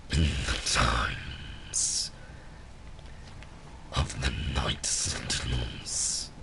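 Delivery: very low, slow, breathy demon whisper; dopey deep voice. Retold or imitated. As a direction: summon the deepest demon voice. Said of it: "I know how to do that voice".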